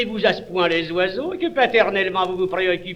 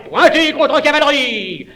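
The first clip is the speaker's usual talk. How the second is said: loud barked command; military; 7 semitones higher, 5 dB louder than usual. Acted. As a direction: bark it like an officer.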